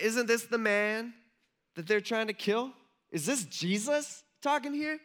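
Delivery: slow, high, incredulous questions; drawn-out questions rising high in disbelief; astonished realization. Acted. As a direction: act out dawning amazement, pitch climbing. Crowd starts to recognize Jesus.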